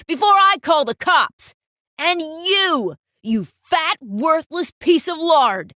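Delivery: roaring, furious tirade at the model; explosive, loud yelling rage; fuming photographer. Acted. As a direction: scream insults like a boss gone wild. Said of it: "he was fuming now".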